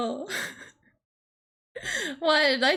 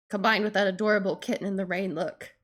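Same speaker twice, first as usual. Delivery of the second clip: ordinary delivery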